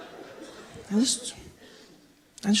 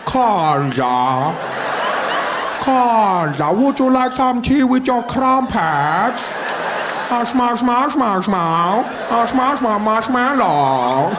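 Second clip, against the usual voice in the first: Zuma cracking up at the posh speaker; hammed-up Zuma imitation of a posh accent; mocking Zuma; 5 dB louder, much slower than usual. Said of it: "and he's like".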